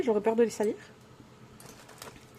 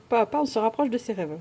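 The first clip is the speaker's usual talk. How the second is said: ordinary talk, nothing marked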